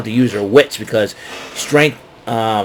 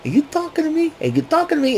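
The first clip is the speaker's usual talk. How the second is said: high, hammed-up De Niro tough-guy voice; De Niro impression; 4 dB quieter, faster than usual. Vlogger hamming it up after the wrestling recap, high mock De Niro voice. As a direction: do a playful over-the-top De Niro. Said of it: "I get a kick out of saying that".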